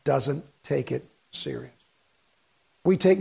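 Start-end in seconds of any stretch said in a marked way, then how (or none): 0.1-1.7 grave rebuke. Real, not acted. Solemn rebuke.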